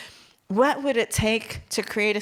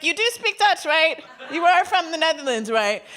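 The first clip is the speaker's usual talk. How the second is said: teasing Dutch; 8 semitones higher, 5 dB louder than usual. Retold or imitated. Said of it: "I'm like".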